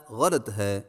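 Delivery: ordinary talk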